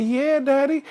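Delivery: high little-bear voice; Baby Bear. Acted. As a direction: answer daddy as a small child. Baby Bear answers his father.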